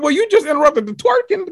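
high put-on voice of an annoyed Robin; mock Robin voice. Cohost plays an annoyed housewife for laughs, high mock voice. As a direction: voice her as put out. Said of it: "It's like".

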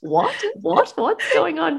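high, bewildered repeated questions; a lightly voiced baffled friend; confused friend. Anna lost in the conversation, high and bewildered.

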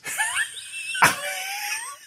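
very high squealing animal wail; animal-noise crying. Retold or imitated. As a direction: imitate crying like a wailing animal. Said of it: "It's animal noises".

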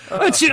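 high, hammy mock sneeze; sneezing. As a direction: fake a prissy little sneeze. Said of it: "if they were like".

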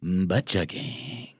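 slow, relishing hum into the words; slow, indulgent savoring. Shown as a demonstration. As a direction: taste the phrase like fine wine. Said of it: "I need you to say".

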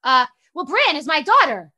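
loud, high flustered over-explaining; choking socially. Retold or imitated. Mom introducing herself to daughter's friend, flustered loud blurting. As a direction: blurt it out, imitating an awkward overeager parent. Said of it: "I fully choked".

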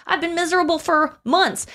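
high-pitched retold complaint; acted exasperated lyric. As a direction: raise the pitch in exasperated protest. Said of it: "These are some of the lyrics".

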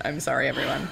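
usual speaking voice

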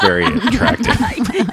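laughing. Real, not acted.

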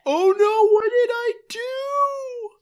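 high, loud wailing panic; realizing the secret slipped, high mock wail; mock horror. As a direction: shriek in hammed-up horror. Mock horror after blurting secret.

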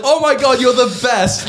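over-eager starstruck praise; giddy gushing. Retold or imitated. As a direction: squeal it like a smitten fan. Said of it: "giddy as fuck".

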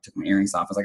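usual speaking voice

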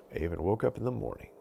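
ordinary delivery

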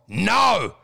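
short, blunt refusal; firm dog command. Retold or imitated. Comedian refusing the partner, as if to a dog. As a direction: shut it down like telling off a dog. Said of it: "like you're talking to a dog".

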